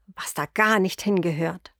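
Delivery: plain reading voice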